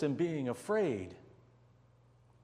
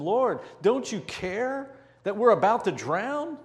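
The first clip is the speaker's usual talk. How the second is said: high, louder frightened plea; desperate plea; 6 semitones higher, 6 dB louder than usual. Retold or imitated. Disciples pleading with sleeping Jesus, frightened. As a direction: beg him to wake up.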